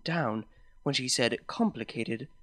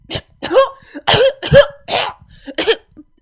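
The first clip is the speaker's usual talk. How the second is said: a brief, loud, raspy coughing fit; 14 dB louder than usual. Acted. Hungover mare coughs over the toilet, loud coughing fit. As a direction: cough hard and high, throat burning. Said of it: "causing me to erupt in a brief coughing fit".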